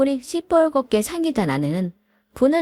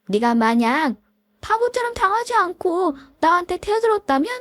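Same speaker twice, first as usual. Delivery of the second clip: higher voiced feuding old classmates; catty women arguing; 8 semitones higher than usual. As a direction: snipe and bicker like offended women. Classmate taunts wife, wife reacts. (동창생 여자들의 날 선 말다툼)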